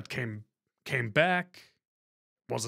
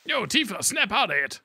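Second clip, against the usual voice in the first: higher, urgent shout at Tifa; Barret shouting; 6 semitones higher than usual. Acted. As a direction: call her back, urgent.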